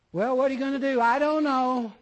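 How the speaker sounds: loud, high acted back-and-forth. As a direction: push it up loud, acting out a heated exchange. Acts out a confronting exchange.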